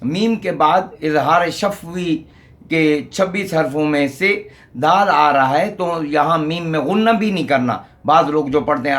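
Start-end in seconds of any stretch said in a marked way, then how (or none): none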